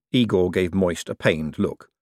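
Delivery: ordinary delivery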